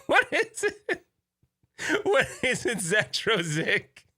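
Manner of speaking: high, squeaky words squeezed through laughter; real laughing fit, slow and high-pitched; helpless giggling. Real, not acted. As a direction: crack up while trying to ask. Helpless giggles over invented word.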